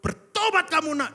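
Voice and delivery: retold angry father's shout; father scolding. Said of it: "Terus dia marah-marah".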